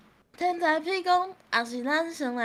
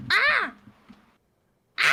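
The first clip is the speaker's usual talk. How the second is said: short, sharp "ah" shouts; loud test shout; 8 semitones higher, 6 dB louder than usual. Shown as a demonstration. Streamer checking with teammate if it is louder, test shouts. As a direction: shout single bursts to test the volume. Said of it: "敢有较大声".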